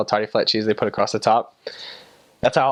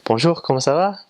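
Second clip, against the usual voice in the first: lilting French "Bonjour, comment ça va"; French accent; 4 semitones higher than usual. Retold or imitated. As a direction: greet in bright French accent. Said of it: "if you're like".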